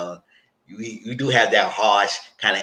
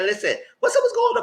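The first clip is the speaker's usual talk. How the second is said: high, sassy mock woman's voice; sassy friend; 4 dB louder, faster than usual. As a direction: play her up high and pushy. Friend confronting the man.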